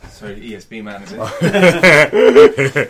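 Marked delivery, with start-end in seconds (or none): 1.1-2.9 laughing, loud. Real, not acted. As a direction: blurt it through laughter. Teasing joke about the ESB man.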